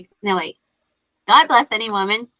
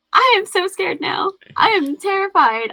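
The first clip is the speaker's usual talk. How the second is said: mock scared; 9 semitones higher than usual. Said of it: "I'm like".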